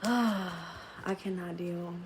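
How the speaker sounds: soft, very slow sighed words; spent sigh. Real, not acted. Recovering after laughing about therapy, spent sigh.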